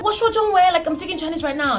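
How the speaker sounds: high, fast insistent protest; mock insistence. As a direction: insist you are speaking Chinese.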